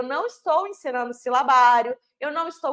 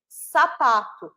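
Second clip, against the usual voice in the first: slow demo word; 3 dB louder, much slower than usual. Shown as a demonstration. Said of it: "eu vou pegar uma palavra".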